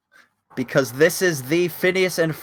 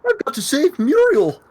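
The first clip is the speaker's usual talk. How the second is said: Courage the Cowardly Dog; 10 semitones higher than usual. Pretend. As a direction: whine it like a timid cartoon dog. Courage impression.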